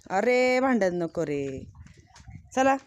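loud call, then slow firm words to stop the fight; breaking up a fight. Real, not acted. Stopping goats from fighting.

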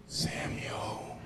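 soft, breathy whispered name; whispered divine call. Acted. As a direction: whisper as the voice in the night. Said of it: "Here's the voice".

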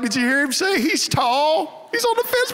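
high, squeaky mocking voice of the kid; teasing family mimics the frightened boy, high squeaky voice; mocking relatives. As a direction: mimic a scared little boy in a shrill falsetto.

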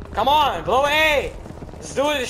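loud, high urging; urgent shout. Real, not acted. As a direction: hype the team to the objective. Urging team at objective.

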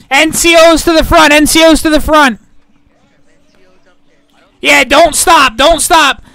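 loud rallying shouts; rally the men forward; calling from far away. Real, not acted. Leading troops into charge.

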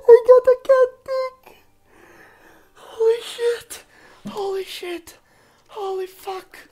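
helpless laughter. Real, not acted. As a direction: squeak it out, crying with laughter.

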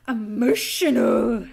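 loud, drawn-out mock drama; hammed-up emotional. Announcing the emotional bit, hammed-up. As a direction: stretch the word slowly and loudly.

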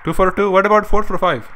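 put-on friendly Indian accent, retroflex t and d. Prank-calling the drive-thru worker in a mock Indian accent. Haggling over nuggets.

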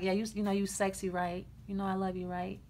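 low, soft sweet talk; understated come-on. Acted. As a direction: murmur it low, barely admitting the affection. Acting out an understated romantic admission.

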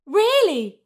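put-on high, eager child character; delighted surprise. A narrator's reading. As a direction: pipe up with keen interest. Sarah delighted by new friend.

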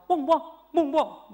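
loud barking woof woof; loud imitated dog barks. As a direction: bark loudly like a dog calling someone back. Dog barks to call him back.

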